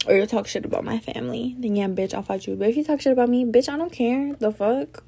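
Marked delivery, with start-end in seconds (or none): none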